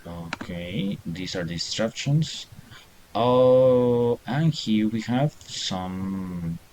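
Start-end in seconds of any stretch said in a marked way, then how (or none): none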